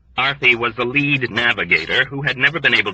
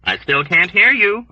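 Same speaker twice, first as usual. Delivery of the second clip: chiding; 8 semitones higher, 4 dB louder than usual. A narrator's reading. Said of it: "Arfie chided".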